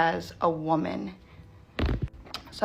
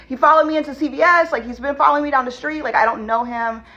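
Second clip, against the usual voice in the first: higher, fast, pleading as if near tears; a touch of retold panic, pitched up and hurried; retold tearful plea; 6 semitones higher, 3 dB louder, faster than usual. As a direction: rush it out, upset and begging to be believed. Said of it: "I'm crying and just".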